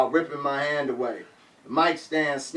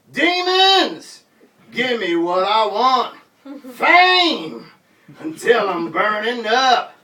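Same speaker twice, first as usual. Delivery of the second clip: much higher, loud stage yell; 6 semitones higher, 6 dB louder, slower than usual. Retold or imitated. Rapper yelling into the mic over his head, loud yell. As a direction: scream it into the raised mic. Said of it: "yelled into it".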